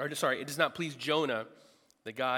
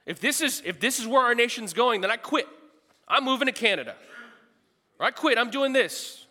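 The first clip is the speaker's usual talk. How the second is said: fed-up quitting rant; 9 semitones higher, 9 dB louder than usual. Pretend. Quoting a fed-up believer.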